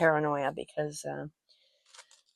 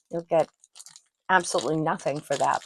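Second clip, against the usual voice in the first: ordinary talk, nothing marked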